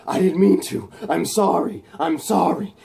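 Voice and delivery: imitated desperate remorse; acted frantic apology. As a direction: plead as if horrified by an accident. Voices Electro pleading apology.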